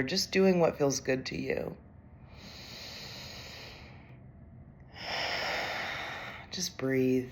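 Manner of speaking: calm, unhurried meditation voice with long pauses; guided meditation. Acted. Executive coach leads overwhelmed leaders through breathing, calm guided meditation. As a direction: guide them gently through each breath.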